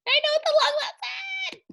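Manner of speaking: sudden high silly put-on voice; a goofy high aside to the kids. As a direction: squeak it out in a cartoon voice.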